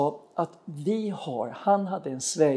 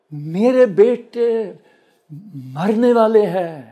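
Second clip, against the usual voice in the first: loud, raised, wailing imitation of a mother's cry; återberättat nödrop; 5 semitones higher, 10 dB louder, slower than usual. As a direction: call it out high and loud, imitating her alarm. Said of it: "ropar hon". (högt, klagande återberättat rop)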